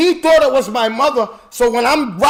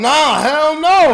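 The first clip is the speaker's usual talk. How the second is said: mock denial; 6 semitones higher, 5 dB louder, much slower than usual. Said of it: "I was going".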